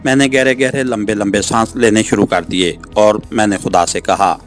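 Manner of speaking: ordinary delivery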